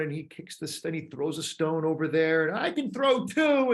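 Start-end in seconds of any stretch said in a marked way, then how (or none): 2.5-3.7 put-on high, proud little boy voice. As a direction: brag like a three-year-old. Child proud at the playground.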